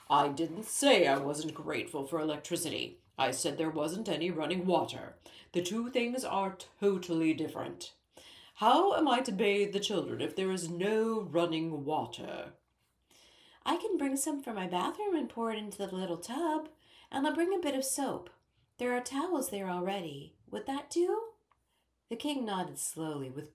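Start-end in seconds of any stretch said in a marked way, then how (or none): 0.1-12.5 pompous king, louder and indignant; fussy little king. A narrator's reading. King corrects Ellie about water versus electricity, indignant and pompous. 13.6-21.3 a lightly voiced girl, higher and helpful; eager young girl. A narrator's reading. Ellie offers the king water and soap, eager and higher.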